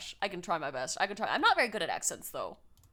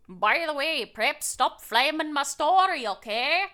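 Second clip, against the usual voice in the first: put-on lilting, amateur Scottish accent; shaky-grasp Scottish-Irish accent; bad Scottish accent; 4 dB louder than usual. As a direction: attempt Scottish and wobble towards Irish. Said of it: "how do I do a Scottish accent".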